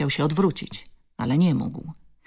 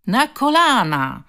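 high, loud, drawn-out call; jeering chorus; 8 semitones higher, 6 dB louder, slower than usual. A narrator's reading. Bully's pals echoing him to the girl, jeering. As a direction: chant the order slowly like a jeering gang. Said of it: "zawtórowali pozostali koledzy".

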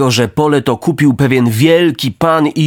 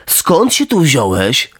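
slow, flat parroting of the fox; just repeat what the fox said; echoing cat; slower than usual. Acted. Cat dully echoing the fox's greeting, slow. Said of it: "powtórzył kot".

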